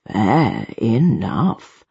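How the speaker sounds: low, very drawn-out concession; deep, lingering, wry agreement; almost amused. A narrator's reading. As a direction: concede slowly with faint amusement. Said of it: "seeming almost amused".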